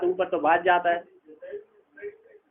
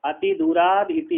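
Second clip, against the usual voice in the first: अति दूराद इति said again slowly, pitched far higher, दूराद stressed and falling; repeated much higher; 13 semitones higher, slower than usual. Shown as a demonstration. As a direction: lift the whole phrase high for emphasis, leaning on दूराद. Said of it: "कहते हैं". (ऊँचे सुर में दोहराया, दूराद पर ज़ोर)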